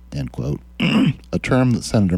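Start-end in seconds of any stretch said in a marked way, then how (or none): none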